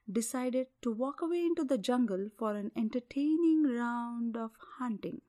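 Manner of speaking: ordinary delivery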